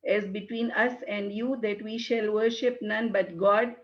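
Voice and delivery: ordinary delivery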